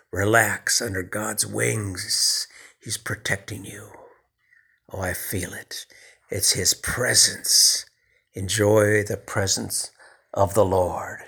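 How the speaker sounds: slow, soft, sinking to a whisper; soothing prayerful hush. Acted. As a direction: hush them into God's presence. Soothing congregation into God's presence.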